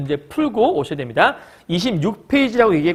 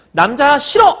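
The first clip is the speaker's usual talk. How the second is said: higher, louder mock "don't like it"; mock outright refusal; 6 semitones higher, 6 dB louder, slower than usual. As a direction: blurt out an exaggerated rejection. Acting out outright dislike as example.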